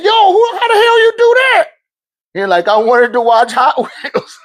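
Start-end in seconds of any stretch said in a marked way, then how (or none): 0.0-1.7 high, loud astonished yell; shocked shout. Retold or imitated. Father shocked at son. 2.7-4.4 put-on laughing kid voice. Voicing son, laughing.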